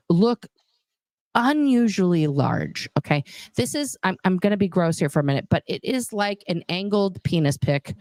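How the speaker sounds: ordinary talk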